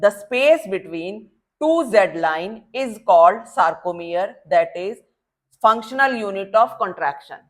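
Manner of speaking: definition read out very slowly, word by word; slow dictation. Shown as a demonstration. Teacher dictating the key definition, slow and deliberate. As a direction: dictate it slowly so students can write. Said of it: "ये सबसे important बात समझ लो तुम".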